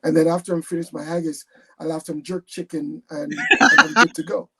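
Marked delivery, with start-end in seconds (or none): 3.6-4.5 laughing loudly through the punchline. Real, not acted. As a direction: laugh out the last words, much louder. Punchline about haggis and jerk chicken.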